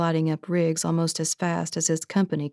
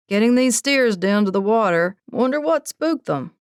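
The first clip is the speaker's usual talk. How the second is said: a lightly voiced ranch hand, raised and carrying; cowhand on the phone; 5 semitones higher, 5 dB louder than usual. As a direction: call it out over restless cattle. Cowhand calling over cattle noise.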